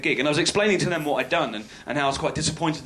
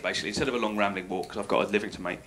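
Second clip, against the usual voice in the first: muttered aside; 7 semitones lower, 6 dB quieter, faster than usual. Real, not acted.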